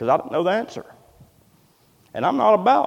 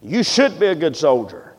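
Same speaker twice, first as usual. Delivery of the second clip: ordinary delivery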